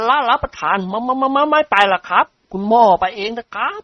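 high, stuttering terrified refusal; stammering fright. A narrator's reading. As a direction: trip over the words in panic. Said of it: "เจ้าแห้วอ้าปากหวอ". (แห้วพูดตะกุกตะกักด้วยความกลัว)

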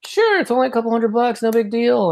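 high, airy mock pushover; lightly mocking carefree approval; breezy pushover voice. Imagined breezy yes to teacher.

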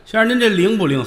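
high, loud questioning voice; customer's eager query. Acted. Customer asking whether the reading works, high loud query. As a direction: pipe up to challenge the fortune teller.

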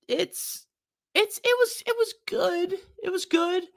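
high, whiny lukewarm praise. Real, not acted. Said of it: "you can tell by the tone of my voice".

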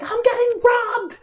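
very high, loud, drawn-out falsetto wail; hammed-up shriek into the phone for help; comic falsetto cry of alarm; mock panicked scream. Acts out frantic emergency call.

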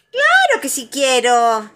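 high, loud, stretched-out yes; eager Tiger. A narrator's reading. Tiger to Igor before jumping on him, eager. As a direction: answer with bouncing eagerness.

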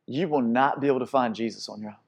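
quiet, hushed, tender invitation; soft closing appeal. Acted. Preacher gently inviting the congregation to come home, hushed.

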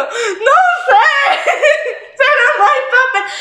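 high wailing sob breaking into laughter; hammed-up laughing sob; mock sob. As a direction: cry it out helplessly. Retelling her crying fit.

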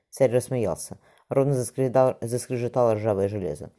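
ordinary delivery, nothing marked

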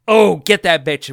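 loud slow heat-of-the-moment snarl; angry guard. Retold or imitated. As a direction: yell it like a riled-up guard. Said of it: "when they're angry".

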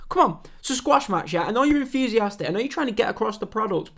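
ordinary delivery

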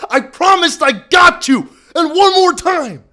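rally yourself before the big moment; hyped shout. Real, not acted. Hyping before dousing himself.

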